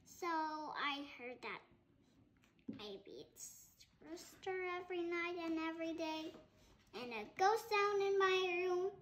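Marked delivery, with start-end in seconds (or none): none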